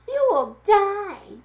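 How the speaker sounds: high, slow trembling warning. A narrator's reading. Frightened girl warning Victor of the voodoo curse, trembling. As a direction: quaver it out in fear.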